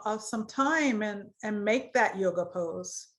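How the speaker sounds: measured phrases with long pauses; guided meditation. Acted. Yoga teacher leads students through root chakra, guided meditation voice. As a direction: guide awareness through the body, leaving space to feel.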